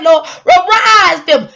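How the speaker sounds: high, loud, slow wailing plea; mock wailing prayer. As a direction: cry out to heaven. Crying shout in the prayer.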